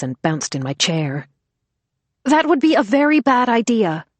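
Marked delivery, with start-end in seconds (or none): 2.2-4.1 high, loud warning over the noise; calling out. Acted. Vero calling out over the arguing women, high and loud. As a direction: call out over arguing voices. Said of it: "Vero called out over the women".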